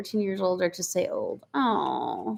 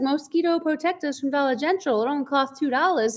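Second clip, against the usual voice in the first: higher mimicked skit voice; imitating comedy skit; 4 semitones higher than usual. Retelling a Dollar General skit, higher playful imitation. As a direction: play the skit girl's silly sales line. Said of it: "She's like".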